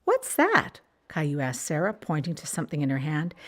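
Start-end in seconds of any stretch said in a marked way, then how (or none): 0.0-0.8 put-on much higher child question; curious child. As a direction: ask it like a curious little boy. Child voiced asking.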